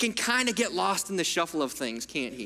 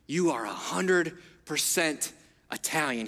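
grandpa voice; 5 semitones lower than usual. Retold or imitated. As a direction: lean in low like a doting grandfather. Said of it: "and he would say".

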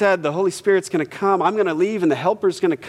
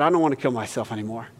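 somber; 7 semitones lower, 5 dB quieter, faster than usual. Retold or imitated. Man's life-changing confession retold.

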